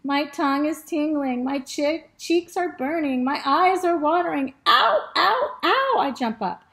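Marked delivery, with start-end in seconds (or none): 0.0-4.5 higher, rising storybook alarm; mock distress. A narrator's reading. Dog's mouth burns from sausage, mounting mock distress. Dog suffers from spicy sausage. 4.7-6.0 high, loud repeated mock yelps; pained yelps. A narrator's reading. Dog jumps up from spicy sausage, high pained yelps.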